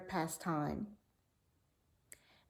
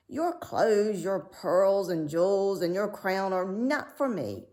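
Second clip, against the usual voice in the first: louder, odd croaky frog voice; proud frog; 4 dB louder than usual. A narrator's reading. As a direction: refuse it grandly as the frog. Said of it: "answered the frog".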